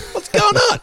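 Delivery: high, loud, comic indignation; mock outraged yell. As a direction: cry out in exasperated disbelief. Mock-outraged rant about his income.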